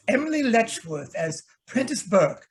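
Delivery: normal talking voice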